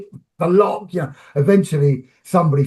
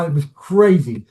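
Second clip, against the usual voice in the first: ordinary talk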